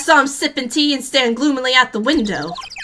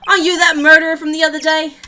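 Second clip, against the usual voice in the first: gruff detective voice; 3 semitones higher, 8 dB louder than usual. Acted. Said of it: "I can't do accents today".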